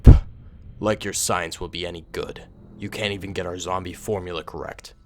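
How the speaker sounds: lightly voiced villain, low and soft; understated low menace from a Sith lord; Sith lord, scornful. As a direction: quietly threaten a disloyal underling. Sith lord threatens his scientist.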